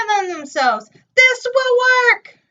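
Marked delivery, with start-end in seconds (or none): 1.2-2.4 much higher, louder proud announcing; proud announcement. A narrator's reading. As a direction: announce it sure of success. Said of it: "they announced".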